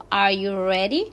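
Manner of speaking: READY rising at the end; rising question intonation. Shown as a demonstration. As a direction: lift your pitch through READY as a yes-no question. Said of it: "la entonación sube".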